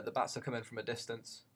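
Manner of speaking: usual speaking voice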